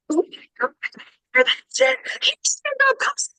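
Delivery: very high-pitched, squeaky gushing; starstruck recognition. Retold or imitated. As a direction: realize who she is and squeal. Imitating fans realizing who she is.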